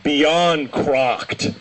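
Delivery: usual speaking voice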